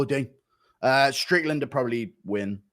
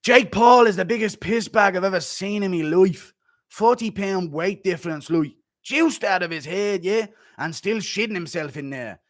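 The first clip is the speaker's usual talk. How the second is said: lightly done mock Irish accent, raised a bit; 3 semitones higher than usual. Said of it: "let me read it in [name]'s voice".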